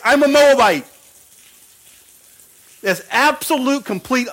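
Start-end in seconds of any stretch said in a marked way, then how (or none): 0.0-0.9 higher, louder plain-spoken Ruth owning it; blunt admission. Retold or imitated. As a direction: state it flat out as Ruth. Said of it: "She's like".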